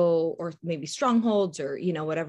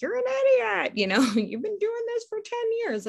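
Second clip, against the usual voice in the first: high-pitched, hammed-up scolding; mock outrage pitched way up, no real anger; mock yell. Mimicking a harsh God who yells at us, mock high yell. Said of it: "yell at us and he's like".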